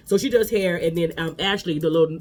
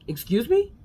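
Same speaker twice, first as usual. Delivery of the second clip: incredulous excuse me; 8 semitones higher, slower than usual. Real, not acted. Mocking the husband's lie.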